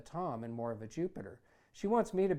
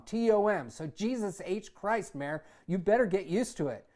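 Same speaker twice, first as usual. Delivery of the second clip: angry insistence; 6 semitones higher, 7 dB louder than usual. Acted. Son insisting on his name.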